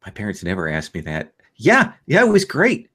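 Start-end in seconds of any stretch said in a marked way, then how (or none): 1.6-2.9 high, bouncy enthusiasm; eager child act. Acted. Ellen tells Serena about school, eagerly. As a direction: gush like a kid after school.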